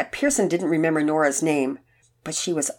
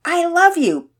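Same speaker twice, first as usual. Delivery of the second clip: high, loud burst of affection; exclaiming; 13 semitones higher, 8 dB louder, slower than usual. A narrator's reading. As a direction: blurt it out with joy. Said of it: "Penelope exclaimed".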